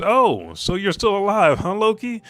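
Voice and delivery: high, loud exclamation, rising further; pleasantly surprised. Acted. Genie Luffy finding Loki alive, surprised. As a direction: call up brightly in surprise.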